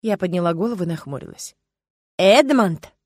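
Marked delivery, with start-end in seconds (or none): 2.2-2.9 loud, stern warning call. A narrator's reading. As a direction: sternly call him to order. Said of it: "проговорила сурово".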